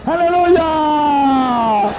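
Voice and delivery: long, very high, loud held hallelujah; ecstatic hallelujah. Real, not acted. Preacher crying out hallelujah to the congregation, high and held. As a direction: lift the whole church with one stretched cry.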